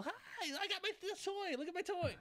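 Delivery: put-on high, squeaky excited kid voice; Bray. Bray up on the table showing his toy, high and excited. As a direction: show off a toy in a silly high voice.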